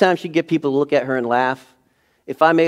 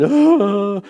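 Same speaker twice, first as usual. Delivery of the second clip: imitated delighted squeal; 9 semitones higher, 7 dB louder than usual. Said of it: "she's laughing".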